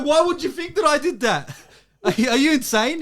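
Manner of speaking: hammed-up accusing protest; retold outrage. Quoting himself arguing with dad, mock outraged. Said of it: "I go".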